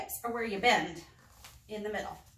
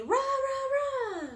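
cheer; much slower than usual. Acted. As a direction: chant it like a pep squad. Cheerleader chant in story.

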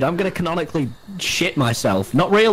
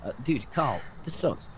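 usual speaking voice

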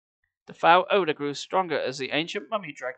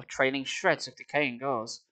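plain reading voice

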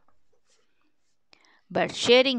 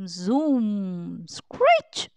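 plane zoom and screech. A narrator's reading. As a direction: voice a toy plane zooming in and screeching. Sound effects of toy airport play.

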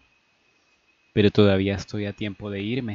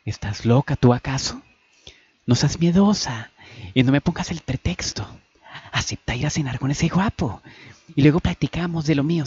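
mild scolding; 5 semitones higher than usual. Acted. Annie pushes her fearful friend.

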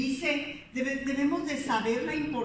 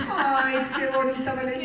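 laughing self-compliment; 2 semitones higher than usual. Retold or imitated. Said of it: "me sonrío y digo".

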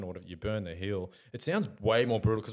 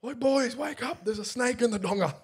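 panicked mate; 5 dB louder, slower than usual. Retold or imitated. Mate panicking about a snake.

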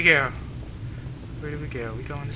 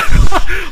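loud, high burst of laughter; startled laugh; 14 dB louder than usual. Real, not acted. Player seeing something wiggle out, sudden high laugh.

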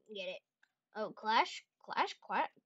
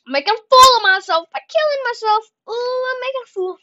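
higher and louder, laughing at himself; loud self-mockery; 11 semitones higher, 13 dB louder, faster than usual. Real, not acted. Gamer dying in game, loud self-mock. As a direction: yell at your own blunder.

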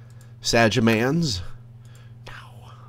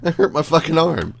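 higher, louder pained outburst; pained complaint; 11 semitones higher, 7 dB louder than usual. Real, not acted. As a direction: complain loudly about real pain. Hurt arm in fall.

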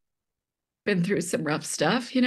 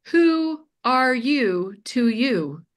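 slow pointed question; 6 semitones higher, much slower than usual. Retold or imitated. Mom presses son with question.

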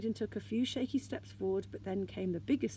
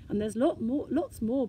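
louder, eager brag; boasting; 3 semitones higher, 6 dB louder than usual. A narrator's reading. Snork showing his sister the gold, boasting. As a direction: show off with punchy emphasis. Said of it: "boasted the Snork".

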